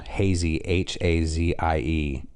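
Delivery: slow, careful letter-by-letter spelling; spelling it out slowly. Shown as a demonstration. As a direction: spell the web address slowly for listeners. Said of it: "it's the hazy nut dot com".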